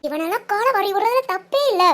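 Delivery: ordinary talk, nothing marked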